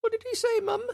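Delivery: squeaky, very high mock child voice; very high hammed-up little kid asking mum; British little kid. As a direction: play a bewildered tiny British child. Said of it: "tucking in their little soldier coats".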